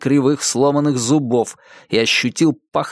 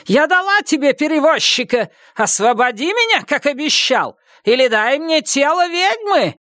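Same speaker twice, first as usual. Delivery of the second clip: deafening scream. Acted. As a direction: scream it up to the sky. Witch keeps shouting demands.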